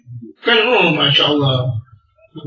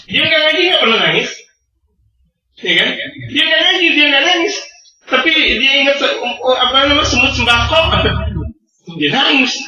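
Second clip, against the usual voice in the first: fiery preaching; 9 semitones higher than usual. Acted. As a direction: drive the point home to the congregation. Preaching at full force. (ceramah berapi-api, nada tinggi dan keras)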